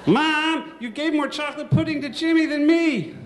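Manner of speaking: put-on high, loud child complaining to mom; whiny kid. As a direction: whine like a greedy little boy.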